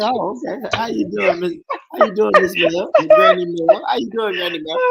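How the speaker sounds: high, sweet-talking grandson voice; mock polite greeting. Retelling how he greeted the grandma, high and sweet. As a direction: put on a polite boy voice for granny. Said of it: "I said".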